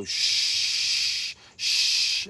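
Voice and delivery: long whispered shh; shushing. Shown as a demonstration. Rabbi quieting a crowd, whispered shh. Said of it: "just go".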